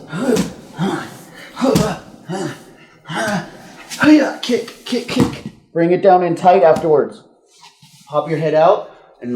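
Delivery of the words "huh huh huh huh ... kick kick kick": high, straining huffs building to a push; strained huh huh with a whispered kick, kick; effort grunts. Shown as a demonstration. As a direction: grunt and huff as if kicking out of a choke. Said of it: "and I was like".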